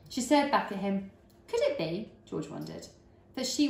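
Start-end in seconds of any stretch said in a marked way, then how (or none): none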